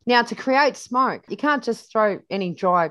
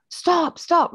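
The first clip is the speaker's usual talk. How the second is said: a high, mildly raised yell of stop; retold yell; 10 semitones higher, 3 dB louder than usual. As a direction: call out to a child to stop, half-voiced. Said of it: "Bruce is yelling out".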